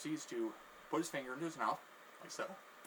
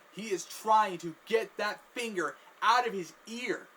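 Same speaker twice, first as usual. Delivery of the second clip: ordinary talk, nothing marked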